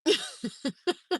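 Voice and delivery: leftover chuckling fit; laugh. Real, not acted. Still amused by the story.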